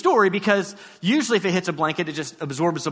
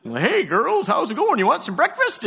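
higher, sing-song cheery dad greeting; dad's voice; 7 semitones higher than usual. Acted. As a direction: greet the kids as a chipper dad. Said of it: "my dad's voice shows up".

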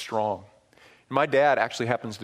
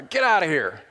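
mild mock yell at a dog; retold yell; 3 semitones higher, 4 dB louder than usual. Boy chasing a dog off the property, retold yell. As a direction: raise the voice a little, imitating a yell. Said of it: "I yelled at this dog".

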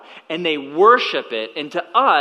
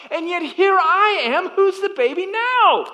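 very high, incredulous self-ridicule; comic self-mockery; 11 semitones higher than usual. Father realizing he is as immature as his son, comic self-mockery.

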